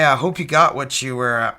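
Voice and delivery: usual speaking voice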